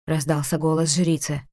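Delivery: usual speaking voice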